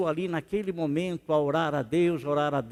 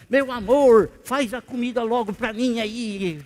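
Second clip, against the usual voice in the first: loud, higher mock order to the wife; bossy demand; 5 semitones higher, 8 dB louder than usual. Husband ordering his wife to make food, bossy mock demand. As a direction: bark the order like a rude husband. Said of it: "você fala para ela assim".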